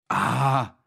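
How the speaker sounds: ordinary delivery, nothing marked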